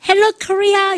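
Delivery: high-pitched concert greeting; visiting pop star's hello. Retold or imitated. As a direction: hail a big crowd, pitched well up. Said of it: "[name]가".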